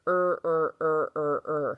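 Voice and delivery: drawn-out "er" sound repeated several times; sound isolated. Shown as a demonstration. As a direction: stretch out "er, er" as a sound drill. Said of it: "let's listen to the E A R here".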